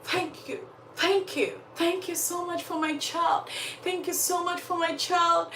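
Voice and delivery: high, soft overjoyed thanks; joyful grateful mother. Retold or imitated. Mother thanking the priest for her living child, high joyful. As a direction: thank them as if the child came back to life. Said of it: "she jumps in joy".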